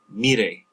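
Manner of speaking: mire said as mee-RAY, weight on the last syllable; final-syllable stress. Shown as a demonstration. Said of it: "stress on that last syllable".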